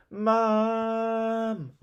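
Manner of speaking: long, high wailing call for Mum. Acted. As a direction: wail for Mum like a wounded child. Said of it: "wailed Perfect Peter".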